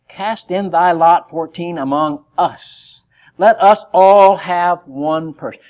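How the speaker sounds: loud, slow, emphatic reading; forceful scripture reading. Acted. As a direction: proclaim the verse slowly and heavily.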